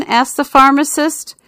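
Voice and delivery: ordinary delivery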